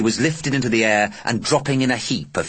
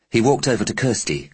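plain reading voice

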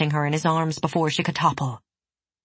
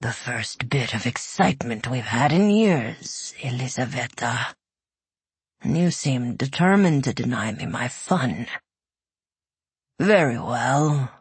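a bored beast giving in; dragon voice; 4 semitones lower than usual. Acted.

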